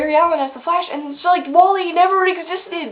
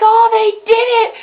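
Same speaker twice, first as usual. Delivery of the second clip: higher, louder retold cheer; mock cheer; 7 semitones higher, 7 dB louder than usual. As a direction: imitate a crowd rejoicing. Retells everyone cheering the success.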